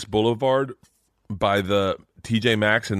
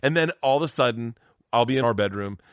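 usual speaking voice

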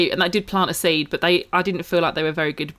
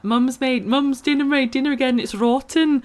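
put-on higher-pitched Scottish little boy; Scottish accent; 7 semitones higher than usual. Quoting a little boy from TikTok, Scottish accent. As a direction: mimic a Scottish TikTok kid. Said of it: "in a Scottish accent".

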